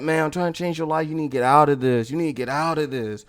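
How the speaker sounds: low, quick earnest plea; pressing, low-pitched warning; lightly voiced preacher. Acted preachy pamphlet man warning.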